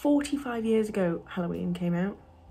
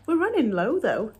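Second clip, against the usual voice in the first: higher, louder sudden notice; surprised; 4 semitones higher, 7 dB louder than usual. Real, not acted. Finding her powder nearly gone, surprised. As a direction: catch the empty pot with surprise.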